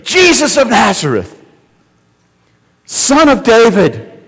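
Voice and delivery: high, loud, slow desperate cry over a crowd. Retold or imitated. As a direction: call out with all you have. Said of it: "this deep guttural scream".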